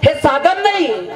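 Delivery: high, drawn-out proclamation; emphatic refrain. Shown as a demonstration. Preacher repeats his point to the crowd, high and emphatic. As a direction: lift it high and stretch it slowly.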